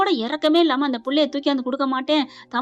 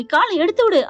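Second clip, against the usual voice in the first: higher, louder acted order; urgent demand; 6 semitones higher, 5 dB louder than usual. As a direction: demand help with a raised voice. Acted demand for help with foot.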